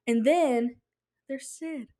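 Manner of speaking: usual speaking voice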